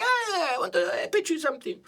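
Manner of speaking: high, hesitant producer voice pitching an idea; imitating his producer. Producer to the host about a risky trip, in a high, hesitant mock voice. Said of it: "And he's like".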